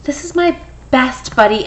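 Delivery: normal talking voice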